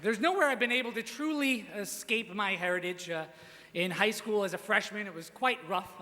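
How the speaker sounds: usual speaking voice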